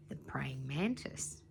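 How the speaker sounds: hushed, slow whisper; whispered reveal. A narrator's reading. Narrator revealing his favourite bug in a whisper. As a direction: breathe it out like a secret.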